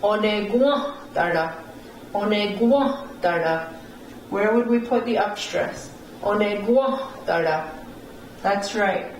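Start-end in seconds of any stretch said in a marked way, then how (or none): 0.0-3.7 "Onegwa dara" twice, gwa stressed, dara dropped; upstress on "wa". Shown as a demonstration. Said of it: "On the wa". 6.2-7.7 "Onegwa dara" with gwa raised; upstress on "wa". Shown as a demonstration. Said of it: "On the wa".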